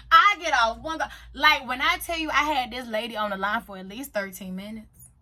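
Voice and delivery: normal talking voice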